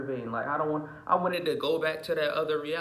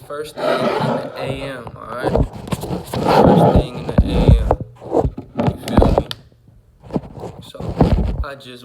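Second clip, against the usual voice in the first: husky just-woke-up voice; gravelly fresh out of bed; rough morning voice; 14 dB louder than usual. Real, not acted. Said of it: "I'm sounding a little rough".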